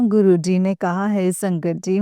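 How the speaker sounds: ordinary talk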